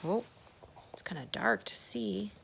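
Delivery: ordinary talk, nothing marked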